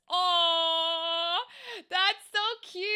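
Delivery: high, drawn-out gushing aww; cooing adoration. Real, not acted. Gushing to a viewer about their biting cat, cooing.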